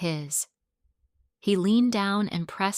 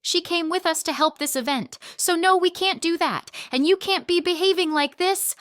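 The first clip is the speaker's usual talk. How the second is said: higher, tense angry yell through gritted teeth; 11 semitones higher, 4 dB louder than usual. Acted. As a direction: hold the rage in your jaw but push it out. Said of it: "I yelled through gritted teeth".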